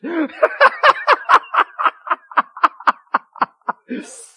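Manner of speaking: hearty preacher's laugh; big loud laugh. Real, not acted. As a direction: burst out in a loud, high laugh.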